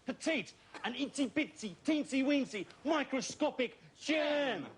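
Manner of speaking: ordinary delivery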